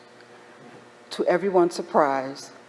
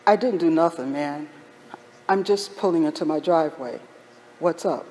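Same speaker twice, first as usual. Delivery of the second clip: normal talking voice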